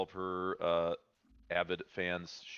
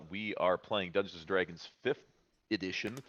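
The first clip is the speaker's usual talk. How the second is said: normal talking voice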